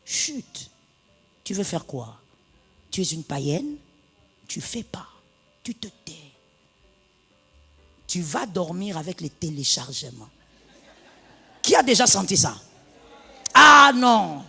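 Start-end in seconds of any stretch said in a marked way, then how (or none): 0.1-10.3 soft, low scolding hush; hushed rebuke. Retold or imitated. The Spirit quietly scolding the angry wife. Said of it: "l'esprit dit".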